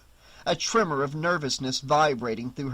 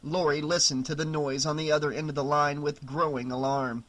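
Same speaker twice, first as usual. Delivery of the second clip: ordinary delivery, nothing marked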